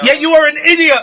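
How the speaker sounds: loud, high-pitched angry insistence; angry retort. Real, not acted. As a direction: hammer the insult back at him. Host quarrels with banned caller.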